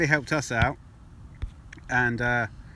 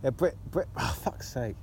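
ordinary talk

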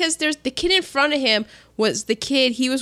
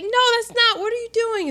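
higher, a touch of mock alarm; alarmed protest; 11 semitones higher, faster than usual. Ralphie realizes he's nodding, mildly alarmed. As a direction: catch yourself in mild mock shock. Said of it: "He's like".

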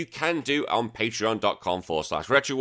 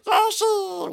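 very high, squeaky silly Yoshi cry; stupid Yoshi voice; 3 dB louder than usual. Acted. Host showing the silly voice his daughter loves, squeaky stupid Yoshi. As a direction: squeal it like a daft cartoon creature. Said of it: "do a really stupid voice".

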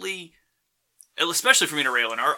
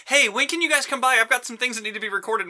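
higher, upbeat imitation of the director asking around; cheery director's text; 10 semitones higher than usual. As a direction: lightly voice the director cheerfully rounding up the cast. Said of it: "a mass text going".

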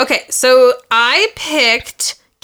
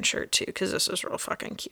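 whispering; 2 semitones lower, 14 dB quieter, faster than usual. Real, not acted. Shopping aside, hushed.

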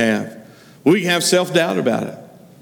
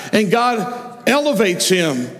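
ordinary talk

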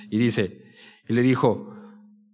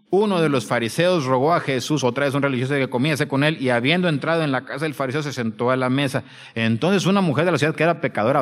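fast, raised-pitch Bible reading; rapid reading; 3 semitones higher, 4 dB louder, faster than usual. Real, not acted. Preacher hurries through the Pharisee story for the congregation, reading fast. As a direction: race through the verses aloud. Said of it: "Lo voy a leer bien rápido".

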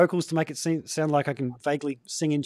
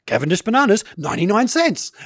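bright supermarket ad voiceover, a touch higher and louder; radio ad read; 6 semitones higher, 5 dB louder than usual. Shown as a demonstration. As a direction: sell the bananas like a radio spot. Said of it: "I used to do a lot of voiceovers".

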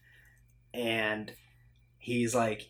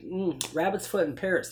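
higher, lightly acted smoothness; suave; 7 semitones higher than usual. Imitating Tom Cruise's range of emotion, lightly suave. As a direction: toss it off like a suave agent. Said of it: "like, suave".

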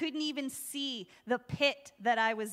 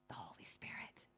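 reverent whisper; 19 dB quieter than usual. Real, not acted. Reverent hush.

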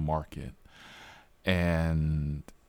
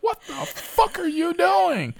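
high, loud, slow shocked cop yell; alarmed police shout; 6 dB louder, slower than usual. Retold or imitated. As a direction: bark it like a startled cop with a gun drawn. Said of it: "the cop's like".

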